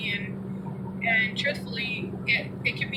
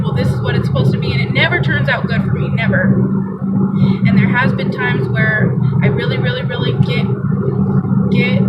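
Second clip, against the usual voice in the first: loud, earnest sermon voice, pushing every line; a mom testifying loudly about prayer in hard times; impassioned preaching; 10 dB louder than usual. Acted. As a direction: preach it out to the congregation with full force. Preaching at full force, testimony.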